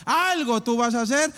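loud, high burst to God; bold declaration of faith. Acted.

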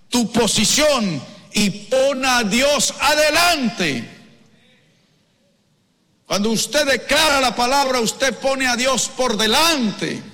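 high proclamation with stretched key words; preaching. Real, not acted. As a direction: urge them on at full pulpit force. Exhorts congregation at full force.

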